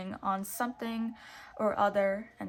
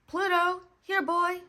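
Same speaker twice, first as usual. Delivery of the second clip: high, loud call under a gate; calling the dog; 9 semitones higher, 7 dB louder than usual. A narrator's reading. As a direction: call your dog back through the gate. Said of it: "Mickey said under the gate".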